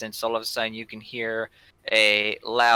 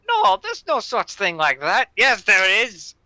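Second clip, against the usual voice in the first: bandit voice; 11 semitones higher, 9 dB louder than usual. Acted. Bandit argues back.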